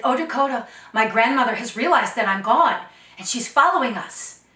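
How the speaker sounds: loud, urgent warning to a companion; alarmed girl calling to her friend. Acted. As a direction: call out to him in alarm. Ihawi warns Dakota of grandmother.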